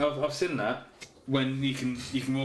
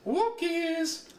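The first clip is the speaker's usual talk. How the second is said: high, drawn-out walkies; excited dog call. Acted. Reading dog facts aloud, calls out walkies excitedly.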